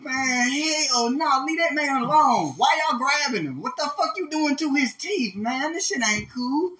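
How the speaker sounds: high, loud, indignant protest; outraged protest. Retold or imitated. Friend playing a bystander at the teeth taking, high loud outrage. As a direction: defend the man as if standing there.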